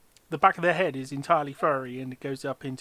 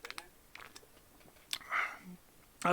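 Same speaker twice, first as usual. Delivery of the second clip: ordinary delivery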